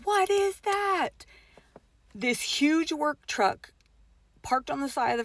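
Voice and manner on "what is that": high, drawn-out cry of alarm; stretched, high-pitched panicked question; imitated scream. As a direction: re-enact a frightened scream, pitched way up. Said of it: "immediately after she screams".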